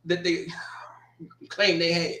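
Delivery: unvoiced aside whisper; sly whispered jab. Real, not acted. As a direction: whisper it like a secret dig. Mocking rival's claimed money.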